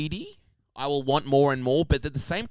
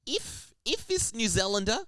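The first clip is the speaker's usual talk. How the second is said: higher-pitched New Zealand accent; 6 semitones higher than usual. Shown as a demonstration. Co-host demonstrates the other accent, high New Zealand accent. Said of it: "you just go more high with everything".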